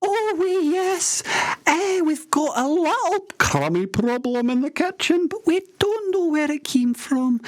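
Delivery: high-pitched comic Scottish radio host. Acted. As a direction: play a squeaky wee Scottish DJ.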